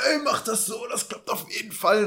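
bragging. Retold or imitated. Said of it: "dann prahlen wir vor dem anderen und sagen".

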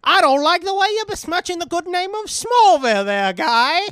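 hammed-up tough guy defending Smallville; indignant tough guy. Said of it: "That was so weird".

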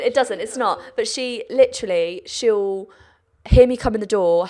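normal talking voice

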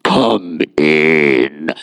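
very deep, drawn-out boom; hammed-up booming voice from behind the door; forbidding father's voice. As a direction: intone it slowly from far down, like a dread ruler. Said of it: "And he heard the sound".

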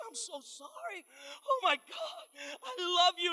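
high, sobbing apology; husband breaking down. Retold or imitated. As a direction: weep the apology, falling apart. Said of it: "he starts wavering".

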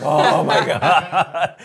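slow, laughing deflated oh my God. Retold or imitated. Fiancée realizing the proposal wasn't hers, laughing. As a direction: laugh at the letdown.

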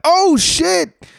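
high, loud startled yelp; startled scream. Retold or imitated. Retelling seeing a big spider at dawn, high startled yelp. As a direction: jump out of your skin. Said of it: "I even screamed".

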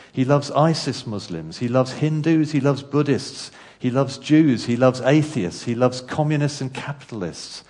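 fervent sermon build; rousing preaching. Acted. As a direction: drive each line home like a pulpit crescendo. Preaching list of who God loves.

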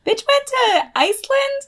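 high indignant mock vent; mock outrage. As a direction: whine like a jealous friend. Mock venting again.

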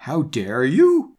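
slow, loud rising "how dare you"; theatrical mock-outraged demand; demanding Snape. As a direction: draw it out indignantly, climbing at the end. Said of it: "demanded Professor Snape".

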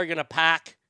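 normal talking voice

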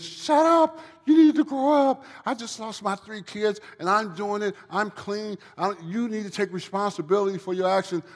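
high, loud scolding rant. Retold or imitated. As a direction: tell him off hard and loud. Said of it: "She's telling me this".

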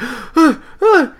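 imitating a child's sharp, high, scared gasps; frightened gasps. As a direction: gasp in fright like a little girl seeing zombies. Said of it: "she starts, like, freaking out".

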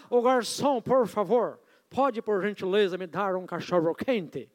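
mock Canadian accent on Portuguese words. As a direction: order a hot dog in John's Canadian-accented Portuguese. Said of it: "vou usar o sotaque que ele usa aqui".

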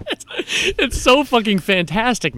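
real laughing praise for the film; laughing delight. Real, not acted. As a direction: savour it, cracking up. Gushing over the movie, laughing.

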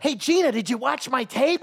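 loud, high mock-earnest question with a laugh; eager young comic. Comic mocking a young comedian asking Gina about his tape, loud and high. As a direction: play the hopeful newbie begging for notes.

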